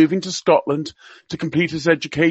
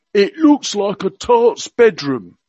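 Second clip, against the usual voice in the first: higher, hammed-up Cockney accent; Prince Philip in Cockney; 5 semitones higher, 4 dB louder, slower than usual. Radio guest quoting royal gaffes to listeners, mock Cockney accent. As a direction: play the old duke's gaffes in mock Cockney. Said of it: "he spoke with a Cockney accent".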